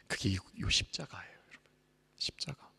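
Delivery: soft unvoiced whisper; whispered reverence. Pastor pointing to the cross, whispering. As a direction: breathe the word like a secret.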